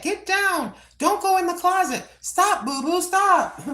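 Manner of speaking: high-pitched scolding of a pet; high, sing-song telling-off for the cat. Real, not acted. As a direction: pitch way up to shoo the cat off the closet. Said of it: "I'm dealing with telling the cat".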